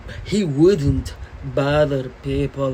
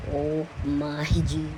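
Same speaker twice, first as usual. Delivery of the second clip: ordinary delivery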